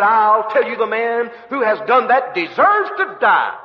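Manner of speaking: indignant king, high and loud; angry. Acted. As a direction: pronounce the sentence in acted outrage. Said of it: "David is angry".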